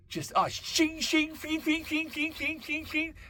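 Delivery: high, repeated metallic shing sounds; sword shing noises. Acted. Comedian voicing metaphorical swords hitting his head, high metallic shing. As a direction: make each blade ring out high and sharp.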